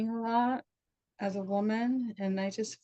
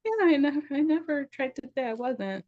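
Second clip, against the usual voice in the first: quoting herself; 8 semitones higher, 5 dB louder than usual. Retold or imitated. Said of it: "I was just like".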